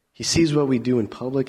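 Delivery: ordinary talk, nothing marked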